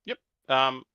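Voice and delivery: ordinary talk, nothing marked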